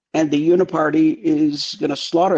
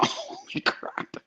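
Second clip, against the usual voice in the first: mock startled gasp; 12 dB quieter, much slower than usual.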